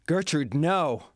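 urgent yell to stop her. Acted. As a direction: shout out in alarm. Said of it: "yelled Claudius".

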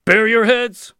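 loud, high-pitched yell of command; sudden yell. A narrator's reading. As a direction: yell it suddenly as a camp head's order. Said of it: "yelled the head of the camp suddenly".

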